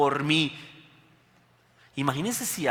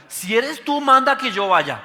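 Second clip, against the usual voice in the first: high, louder bold challenge; bold Peter; 9 semitones higher, 9 dB louder, slower than usual. Retold or imitated. Preacher voicing Peter on the boat, high bold challenge. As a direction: Peter daring Jesus, pitched high and loud. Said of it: "mire lo que pregunta".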